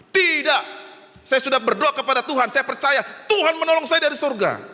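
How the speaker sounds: high, insistent declaration; emphatic refusal. Acted. Flood victim refuses helicopter, high emphatic insistence. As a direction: act stubborn faith, pitch held well up.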